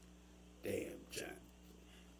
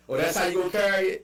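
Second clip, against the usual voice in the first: quoting locals; 5 semitones higher, faster than usual. Retold or imitated. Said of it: "I thought everybody said that".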